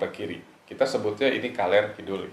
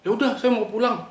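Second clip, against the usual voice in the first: high sharp retort, much higher; snapping friend; 13 semitones higher, 4 dB louder than usual. Retold or imitated. Friend snaps she wants to go home, high and sharp. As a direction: snap it back like a sudden temper. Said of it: "Bentak gitu?".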